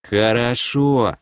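медленно и довольно; просиявший простак соглашается; радостно, просветлев. Acted. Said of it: "просветлел лицом лысый эльф". (медленное простодушное согласие)

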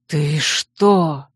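strangled gasp of shock; choked gasp. Acted. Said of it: "Вал придушенно ахнул".

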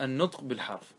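ordinary talk, nothing marked

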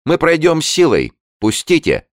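very high-pitched narrated cry at the officer; high, forceful acted shout; shouted defiance. A narrator's reading. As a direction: cry it out, demanding to be let through. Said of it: "крикнула она офицеру".

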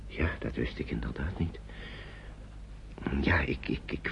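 soft whispered reply; hushed, taken aback. Acted. Mr Hoort answers Nicole's confession, softly whispered. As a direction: answer softly, caught off guard. Man moved by her confession.

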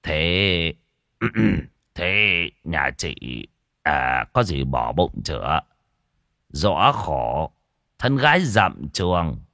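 put-on low, gentle older man's voice with hesitations; sympathetic questioning. A narrator's reading. Official questions a gaunt pregnant girl, low and pitying. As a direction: pity her as you gently probe. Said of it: "thì gặng hỏi".

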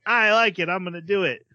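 high, loud, gung-ho burst; hammed-up enthusiasm; mock eager acceptance. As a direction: jump at the challenge, playfully overdone. Acts Diego eagerly accepting duel.

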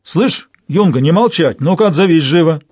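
loud brutish barking order; orc. Acted. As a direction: snarl out the command. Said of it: "Торк рявкнул".

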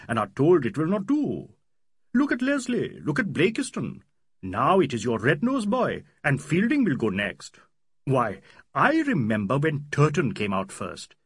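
put-on an old Cambridge man making his case; earnest older man. Hamidullah argues with older authority.

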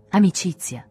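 loud, forceful protest of love; violent outburst. A narrator's reading. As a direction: hurl it at her, fierce and loud. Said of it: "disse con violenza". (con violenza, a voce alta)

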